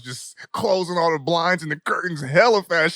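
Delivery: usual speaking voice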